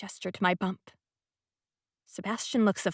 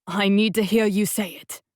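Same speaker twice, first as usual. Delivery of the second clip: demanding; 6 dB louder than usual. A narrator's reading. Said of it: "he demands".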